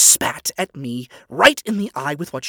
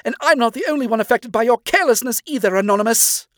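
higher and louder, building to a furious rant; angry father; 6 semitones higher, 4 dB louder than usual. Acted. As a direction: let the rage climb with every clause. Stolas angry tirade.

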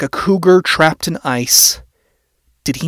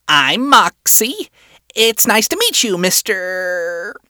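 high, polite little imp voice; scratchy high-pitched imp; 5 semitones higher, slower than usual. A narrator's reading. Male employee greeting a client, scratchy and high-pitched. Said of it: "voice scratchy and high-pitched".

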